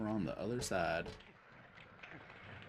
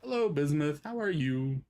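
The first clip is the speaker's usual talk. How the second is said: loud greeting; 7 semitones higher, 7 dB louder than usual. Pretend. Greeting game character loudly.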